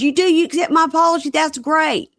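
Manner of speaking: high, quick mock delight; hammed-up eager relief; delighted husband imitation. Voicing husband delighted at forgiveness.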